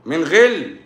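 slow, intoned reading of the verse; quoted verse. Shown as a demonstration. Preacher quoting a Quran verse to viewers, slow intoning. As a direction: recite it deliberately, word by word.

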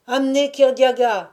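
high, loud order; crowd cry. A narrator's reading. Crowd demanding the winner be brought, crying out. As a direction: shout for him to be brought. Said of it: "s'écria-t-on aussitôt".

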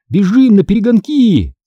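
high, excited challenge; shouting. A narrator's reading. Brother challenging sister to race home, shouting. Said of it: "крикнул он".

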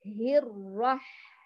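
هر، رح drawn out slowly; slow syllables. Shown as a demonstration. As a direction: stretch هر and رح slowly. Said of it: "انتبهوا، شوفوا".

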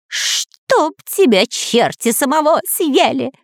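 high, drawn-out curse, not a full shout; cross cry. A narrator's reading. Spy curses the spirit, high indignant cry. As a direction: curse back slowly in a high voice. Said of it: "крикнул Дырка".

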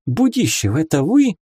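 sudden surprised recognition; amazed. A narrator's reading. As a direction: ask in amazement, pitch lifting. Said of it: "изумилась она".

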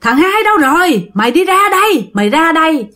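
high-pitched angry calling-out; acted doorstep tirade, pitched well up; father cursing outside. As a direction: demand he come out, like an enraged father. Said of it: "Ba đứng ở ngoài ba chửi".

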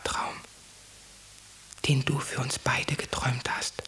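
hushed intimate whisper. Acted. Whispered dream interpretation.

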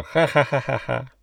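put-on kookaburra laugh; hammed-up ha-ha-ha of a bird; hysterical bird cackle. A narrator's reading.

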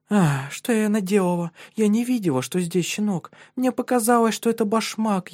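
put-on high, distressed girlish voice; flustered young woman. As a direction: excuse yourself, flustered and high. Bergitta upset after kicking puppy.

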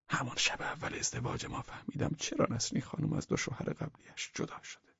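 plain reading voice

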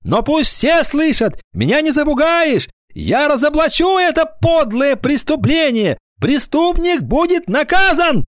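put-on high-pitched impassioned boy denouncing a crime; high, declamatory schoolboy protest; indignant schoolboy accuser. A narrator's reading. Алик обличает на собрании.

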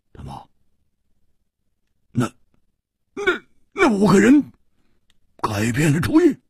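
halting, hoarse, rising to high disbelief. A narrator's reading. Hu Tiehua fearing the five changed their minds, hoarse voice. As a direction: start hushed and hoarse, stammer upward. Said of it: "胡铁花哑声说".

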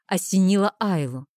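plain reading voice

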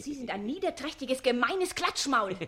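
indignant outburst at the gossiping music master; angry insult. Acted. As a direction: hurl the name at him.

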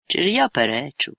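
plain reading voice

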